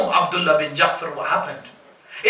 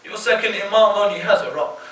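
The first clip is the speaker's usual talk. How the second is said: ordinary delivery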